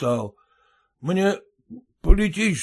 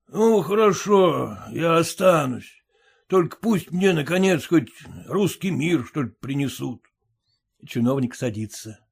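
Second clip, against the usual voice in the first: usual speaking voice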